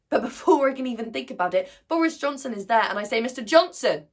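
loud, high rush building to a shout; urgent calling out. Acted. Student confronting the Prime Minister, urgent loud call.

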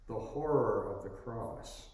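normal talking voice

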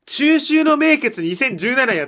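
loud, high, slow exasperation; exasperated outburst. Real, not acted. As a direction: blurt out the embarrassing title. (呆れた叫び)

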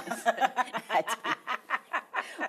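a high, warm burst of laughter; hearty laugh. Real, not acted. Laughing at friend's reaction.

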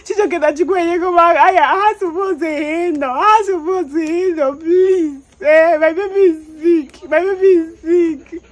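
real anguished plea, high and loud; distraught pleading. Real, not acted. Distraught mother pleading for sick baby.

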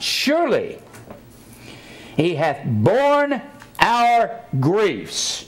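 hushed, reverent King James delivery; solemn scripture reading. Acted. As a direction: stretch each word out like a holy proclamation.

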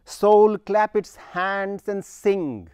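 Soul called out high and loud, then slow; stress on Soul. Shown as a demonstration. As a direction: proclaim Soul like a summons. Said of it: "he goes on and says".

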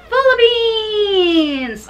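long, drawn-out cheer for the country; excited cheer. Real, not acted. Fan plans to shout for Philippines, excited cheer. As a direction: cheer it slowly, letting the pitch fall away.

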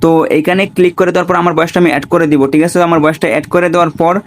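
ordinary talk

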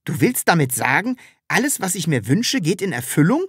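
stirred up, incredulous; agitated. A narrator's reading. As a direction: ask it eagerly, pitch climbing. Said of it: "fragte Herr Taschenbier aufgeregt".

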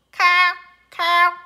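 loud, harsh crow caw; loud bird caw. Acted. Crow call in story.

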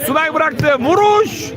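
screaming football commentator. Real, not acted. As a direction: build to a high yelled shot call. Shot attempt in live commentary.

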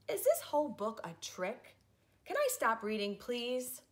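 ordinary delivery

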